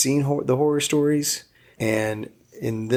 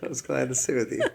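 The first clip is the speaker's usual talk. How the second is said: laughing. Real, not acted. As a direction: chuckle it out fondly. Laughing while thanking wife.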